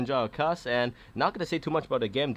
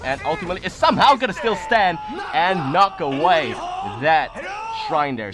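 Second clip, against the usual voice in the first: raised, emphatic climactic call; excited caster at the final push; live play-by-play; 5 semitones higher, 6 dB louder than usual. Real, not acted. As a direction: hammer out the decisive play word by word.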